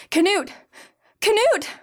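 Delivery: high, loud terrified cry for help; frightened shriek toward the door; screaming in terror. A narrator's reading. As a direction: scream his name in fear. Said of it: "she screamed in terror".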